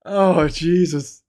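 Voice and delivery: high, loud, slow exasperated curse; exasperated outburst. Real, not acted. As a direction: let the frustration burst out, drawn out.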